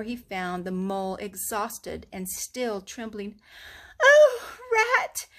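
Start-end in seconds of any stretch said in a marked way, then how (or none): 4.0-5.3 high, loud cry of relief and fear; frightened cry. A narrator's reading. Frightened Mole cries out to Rat, high and shaky. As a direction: sob it out as rescue comes. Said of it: "he cried".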